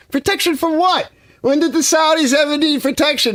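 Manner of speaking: high, scoffing disbelief at the offer; incredulous. Real, not acted. Scoffing at Saudis needing protection, incredulous. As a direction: ask it like the idea is absurd.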